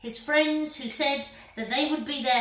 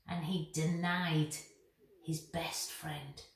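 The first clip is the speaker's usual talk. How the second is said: quiet; 5 semitones lower, 5 dB quieter than usual. A narrator's reading. Peter's heartbreak, hushed telling.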